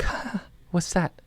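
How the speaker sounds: soft, high gasp of wonder; hushed little startled question; softly startled. Acted. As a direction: gasp lightly, then ask softly. Acted startled wondering quote.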